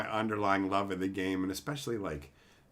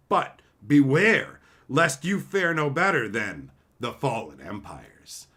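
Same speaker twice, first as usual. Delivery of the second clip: epic trailer narration; dramatic flavor-text read; 5 dB louder than usual. Acted. Reads card flavor text.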